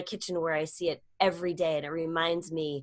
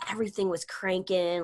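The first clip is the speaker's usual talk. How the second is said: normal talking voice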